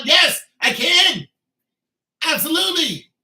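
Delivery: high, loud, eager shout of a simp; mock the guys who jump to say yes; eager yes-man. Mocking the guys who answer smart women with a loud, eager yes. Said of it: "some guys are going".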